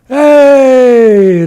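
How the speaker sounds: high, loud, drawn-out yell; gleeful shout falling in pitch; long whoop. Acted. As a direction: holler all the way down the slide. Iktomi whoops sliding down the bank.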